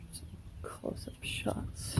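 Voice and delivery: whispering. Real, not acted. Whispering to camera near chicks.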